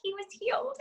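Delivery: high, tearful and choked up; blurting it out through tears of relief; crying. Real, not acted.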